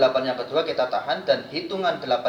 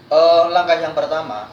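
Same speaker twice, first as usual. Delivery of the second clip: loud; 4 semitones higher, 11 dB louder than usual. Real, not acted. Loud emphatic restart.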